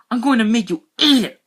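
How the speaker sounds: high, loud furious yell; angry shout. Acted. Boy shouting a threat at his brother, angry. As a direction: threaten him at full voice. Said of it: "I shouted angrily".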